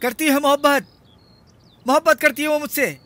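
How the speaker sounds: very high-pitched cry of joy; exultant, voice leaping far up; elated exclamation. Acted. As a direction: call it out, overjoyed and amazed. Lover exulting that she loves him.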